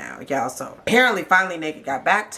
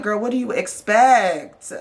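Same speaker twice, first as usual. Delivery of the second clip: higher, louder slow sass; sassy; 5 semitones higher, 4 dB louder, slower than usual. Real, not acted. Vlogger talking back to the cast member, slow and sassy. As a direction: talk back to her with attitude.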